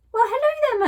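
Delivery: high, loud, delighted "well, hello there"; surprised greeting. Acted. Mum surprised to find her child still awake, high and bright. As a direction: pitch jumps way up in surprise. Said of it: "she was surprised to see me".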